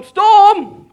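loud, high shouted command. Retold or imitated. Preacher acts Jesus rebuking the storm, commanding shout. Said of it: "he said to the storm".

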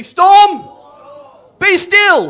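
loud, high rallying shout; commanding shout. Shown as a demonstration. Preacher leads congregation in rebuking the storm, commanding shout. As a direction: shout it for a crowd to echo. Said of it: "Go and say it with me".

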